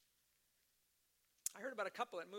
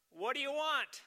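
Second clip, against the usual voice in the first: suspicious shout through the door; gruff call; 7 semitones higher, 10 dB louder than usual. Retold or imitated. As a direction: bark it out, loud and wary. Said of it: "someone called out".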